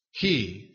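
"He" set alone with a falling tone; emphatic HE. Shown as a demonstration. Preacher singling out the key word, "He" emphasized. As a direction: land "He" as a complete, weighty word. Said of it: "the emphasis needs to be on that".

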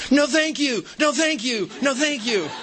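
put-on high child's voice, politely refusing; polite little girl. Little girl refusing the nurse's shot, high child voice. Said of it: "She goes".

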